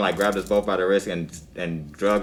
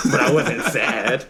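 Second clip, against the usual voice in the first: laughing; 4 semitones higher, slower than usual. Real, not acted. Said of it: "I was seeing red".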